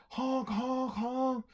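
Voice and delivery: high honk honk, like a horn; car horn honk. Acted. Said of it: "he honked the horn on the car".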